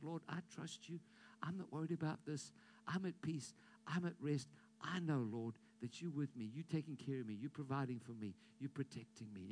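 hushed confident prayer; quiet prayer. Acted. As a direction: pray it gently, at peace.

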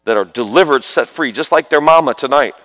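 loud, driving prayer over the family, full preaching force; fervent prayer. Real, not acted. Preacher praying over a mother's sons, loud fervent prayer. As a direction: pray it out loud like a revival preacher.

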